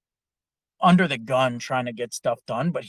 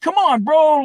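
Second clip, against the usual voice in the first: much higher, louder cheer; mock hype-up shout; 11 dB louder than usual. Drunk movers pushing him to lift, loud hype shout. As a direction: egg him on like a gym buddy. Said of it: "they're just trying to motivate me".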